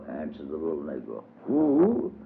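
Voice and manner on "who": astonished. Acted. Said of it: "in astonishment".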